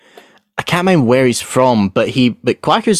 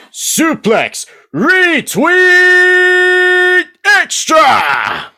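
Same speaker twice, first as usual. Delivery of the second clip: huge drawn-out wrestling announcer shout; announcer yell; 4 dB louder, much slower than usual. Retold or imitated. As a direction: bellow it like a ring announcer. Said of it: "I did an advert for it with that voice".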